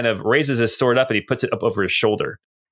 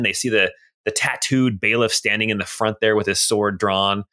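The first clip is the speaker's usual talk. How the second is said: ordinary delivery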